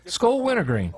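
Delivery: lightly voiced high ad pitch; TV commercial pitch. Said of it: "he would do these commercials for Skoal".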